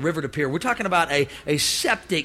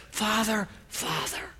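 whispered, pleading Father; soft pleading; 10 dB quieter, slower than usual. Acted. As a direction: breathe it out, humbly begging. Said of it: "here's what the King James says".

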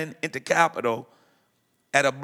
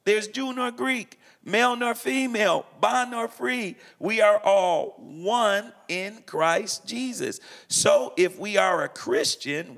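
higher-pitched, slower sermon cadence for scripture; preaching scripture; 5 semitones higher than usual. Acted. Preacher quoting Galatians to the interviewer, preaching cadence. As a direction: preach the verse like a pulpit reading.

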